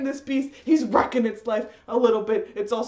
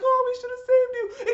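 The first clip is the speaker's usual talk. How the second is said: high mock lament; mock mournful friends; 13 semitones higher than usual. Friends mourn a hero who is still alive, high mock lament. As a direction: sob it theatrically, much higher. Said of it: "they're like".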